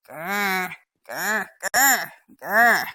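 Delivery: loud, repeated mock growls; playful growl. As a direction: growl back at a puppy in play. Play-growling with the puppy.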